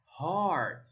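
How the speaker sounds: "heart" stretched with a long ah; long ah, slow. Shown as a demonstration. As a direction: draw out "heart" slowly. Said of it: "So it's heart".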